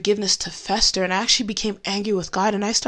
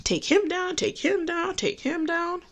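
egging on; 8 semitones higher, 4 dB quieter than usual. Retold or imitated. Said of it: "I was mad".

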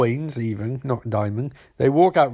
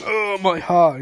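lightly hammed-up wounded groan, higher pitch; mock agony, pitched up a little; mock dying cry; 5 semitones higher, slower than usual. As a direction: play-act being shot in the heart. Mimics shot dad falling down.

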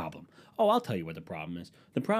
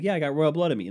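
proud declaration; 6 semitones higher, 5 dB louder, faster than usual. Pretend. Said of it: "I'll be like".

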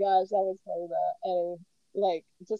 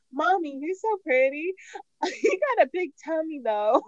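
lightly imitated child, higher pitched; loud little kid; 7 semitones higher, 4 dB louder than usual. Lily to her mommy in the changing room, mock loud child. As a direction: imitate a little girl announcing it openly. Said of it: "she was so loud about it".

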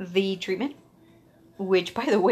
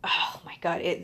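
ordinary talk, nothing marked

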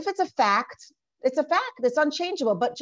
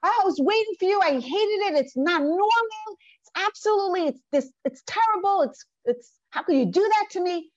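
higher mock outburst, hurt and accusing; retold scream; 6 semitones higher than usual. Wife screaming at her late husband, a mock outburst. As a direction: act out her shrill complaint, raised high. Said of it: "she screams".

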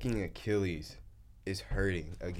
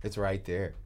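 ordinary delivery

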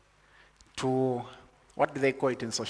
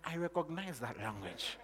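quiet recognition; 13 dB quieter than usual. Retold or imitated. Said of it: "And you're like".

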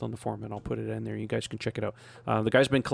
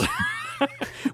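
big laugh. Real, not acted. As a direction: burst out laughing. Laughing at being the worst.

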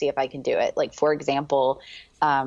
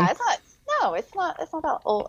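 much higher, quick "yeah, it's not"; eager agreement; 11 semitones higher than usual. Real, not acted. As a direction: jump in to agree, pitched way up. Agreeing her channel is new.

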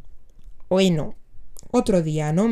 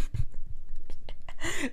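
breathless laugh; 8 semitones higher, 3 dB quieter than usual. Real, not acted. Laughing at the lyric.

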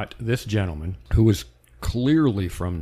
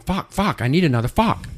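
high, louder mock Maine man demanding "another fuck" for fork; Maine accent, insisting; 8 semitones higher, 6 dB louder than usual. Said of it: "And he's going".

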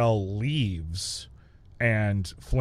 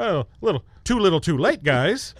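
high teasing jab; sing-song scolding; mocking; 7 semitones higher, 4 dB louder than usual. Real, not acted. Mocking the heroes' late move.

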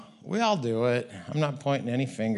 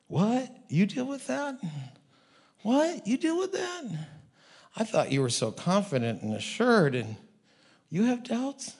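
much higher astonished questions; incredulous; 6 semitones higher than usual. Retold or imitated. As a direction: gasp it out in disbelief.

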